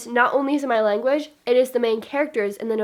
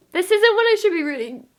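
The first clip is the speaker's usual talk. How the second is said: 1 choked-up squeak; 8 semitones higher, 4 dB louder than usual. Real, not acted. Emotional dedication page reaction.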